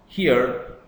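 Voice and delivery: ordinary talk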